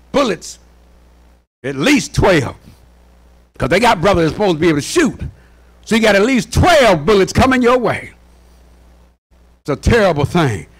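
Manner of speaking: usual speaking voice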